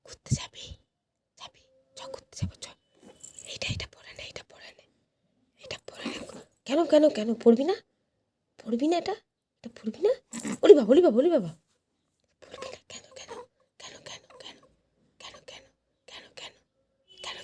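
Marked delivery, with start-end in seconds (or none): 0.0-6.2 unvoiced whisper, coaxing a pet. Real, not acted. 12.4-17.4 unvoiced whisper, coaxing a pet. Real, not acted.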